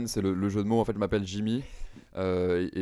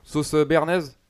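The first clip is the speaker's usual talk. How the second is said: higher, louder regular ordering; kebab customer impression; 7 semitones higher, 5 dB louder than usual. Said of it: "imiter un grec". (imitation d'un client de kebab, voix aiguë et forte)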